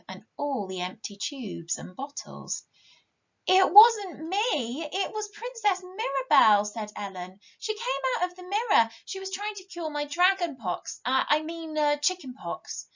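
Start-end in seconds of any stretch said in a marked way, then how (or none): 3.5-6.6 put-on high, slow, pleading child's protest; a small girl caught out, insisting it wasn't her; high innocent child voice; guilty little girl. Ellen's excuse in read story. 7.6-12.9 put-on a small girl caught out, insisting it wasn't her; high innocent child voice; guilty little girl. Ellen's excuse in read story.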